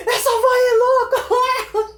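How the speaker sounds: high, loud, slow cry through laughter; laughing shout. Retold or imitated. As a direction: yell it while doubled over laughing. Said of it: "ele gritava".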